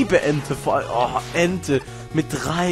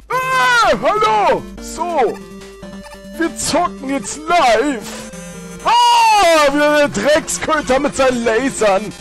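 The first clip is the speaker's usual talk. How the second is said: berserk rage; 9 semitones higher, slower than usual. Real, not acted. Raging at enemy.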